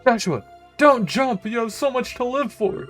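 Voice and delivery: high, loud panicked plea; desperate shout. Acted. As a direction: yell to stop him jumping. Shouting to stop him jumping.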